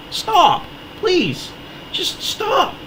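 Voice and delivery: high strained voice breaking on the pleas; pleading so hard the voice cracks up high; real voice crack from getting worked up. Real, not acted. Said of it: "my voice is cracking".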